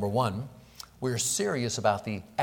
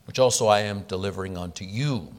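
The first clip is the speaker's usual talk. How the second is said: 1 reading scripture; 4 semitones higher, 5 dB louder than usual. Acted. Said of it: "This explanation is divinely declared".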